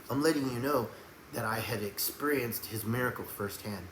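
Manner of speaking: soft, low-key talk to an unbeliever; quiet, easygoing and non-confrontational; gentle witnessing. Shown as a demonstration. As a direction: explain it calmly, without pushing. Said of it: "See the way I'm talking to him?".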